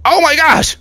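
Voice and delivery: high, loud startled exclamation; startled cry. Real, not acted. Player startled by what pops up, startled cry. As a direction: jump out of your skin, voice shooting up.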